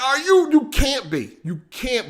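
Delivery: high, stretched-out mock holler; half-laughing disbelief at a friend; retold hollering. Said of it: "I was fucking hollering".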